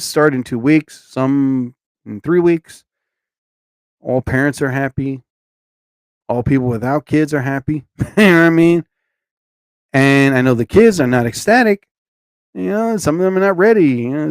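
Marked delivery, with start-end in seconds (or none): none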